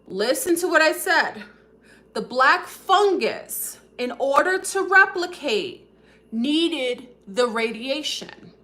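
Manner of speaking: high, loud, deliberate point-making; emphatic insistence. Real, not acted. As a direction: drive each phrase home with pauses between. Repeats key point slowly for emphasis.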